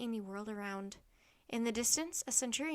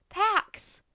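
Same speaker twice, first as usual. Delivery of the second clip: high, loud cry for peace; shouted plea; 9 semitones higher, 11 dB louder than usual. A narrator's reading. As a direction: shout it to armed riders. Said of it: "Annie shouted".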